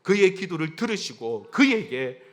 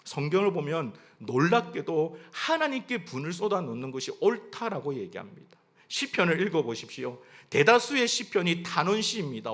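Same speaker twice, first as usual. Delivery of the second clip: usual speaking voice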